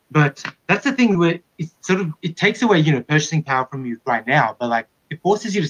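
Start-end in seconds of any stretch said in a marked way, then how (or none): none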